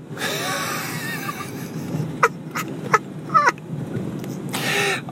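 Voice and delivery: long wheezing laugh. Real, not acted. Dad amused by his son's insult, wheezing laughter. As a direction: crack up at your own impression. Cracks up at his own impression.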